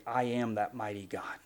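low, soft declaration; quiet God quote. Acted. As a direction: state it low and steady, without force. Voices God's quiet declaration.

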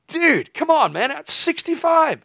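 very high, loud comic pleading; exasperated protest. Acted. Driver pleading with the tailgating eighteen-wheeler, high exasperated protest. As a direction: squeal it at the driver behind you.

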